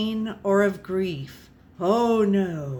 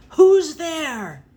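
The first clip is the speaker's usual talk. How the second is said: put-on old man's cry, higher and louder; startled cry; 7 semitones higher, 8 dB louder than usual. A narrator's reading. Old man sitting up in bed calling out to the dark, startled and loud.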